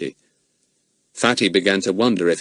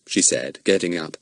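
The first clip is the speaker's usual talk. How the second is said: usual speaking voice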